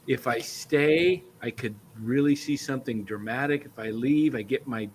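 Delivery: musing to self. Acted. Fan deciding whether to stay or get the stick back, quiet musing. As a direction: weigh it to yourself, almost under your breath. Acting out inner deliberation.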